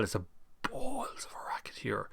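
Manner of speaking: whispered aside under the noise. Real, not acted. Streamer muttering about the racket, whispered aside. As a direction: hush it, just breath.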